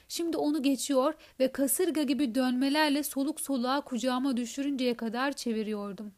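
ordinary delivery, nothing marked

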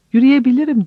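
high, loud, resolute answer; ringing, determined reply; firm and dignified. A narrator's reading. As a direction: answer instantly with proud certainty. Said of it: "kesin ve onurlu bir davranışla".

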